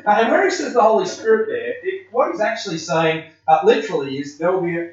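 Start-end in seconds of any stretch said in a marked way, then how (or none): none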